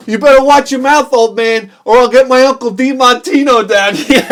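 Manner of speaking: put-on loud, high tough-kid taunt; cocky kid threat. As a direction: mouth off like a punk kid threatening a grown man. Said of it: "He goes".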